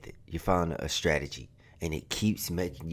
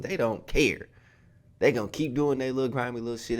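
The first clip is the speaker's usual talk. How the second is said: ordinary delivery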